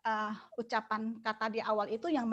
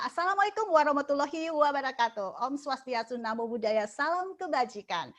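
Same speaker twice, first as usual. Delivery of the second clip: higher and louder, spirited "Assalamualaikum"; energetic greeting; 4 semitones higher, 3 dB louder than usual. Shown as a demonstration. Teacher showing students an energetic opening greeting, higher and louder. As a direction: open with lifted, rousing energy. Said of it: "itu memancing kita untuk energik ya".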